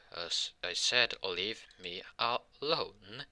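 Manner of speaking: slow, stretched-out warning; acted insistence, very drawn out; drawn-out demand. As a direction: spell it out slowly, dragging each word. Acted warning, repeated demand slowly.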